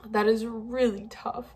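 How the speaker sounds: same words but choked and crying; tearful repeat. Real, not acted. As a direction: let the repeat crack with tears.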